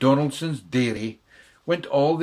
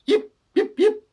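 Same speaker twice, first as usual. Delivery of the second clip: very high, sharp yips; tiny dog yip; 9 dB louder than usual. Acted. Schnitzel von Krumm yips, very high. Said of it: "said Schnitzel von Krumm".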